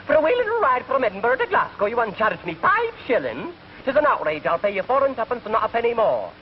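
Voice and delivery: high-pitched haggling Scotsman; indignant Scottish accent. Acted. Scotsman arguing over the fare, indignant Scottish accent. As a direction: protest the price in a comic Scots voice. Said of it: "And the Scotch says".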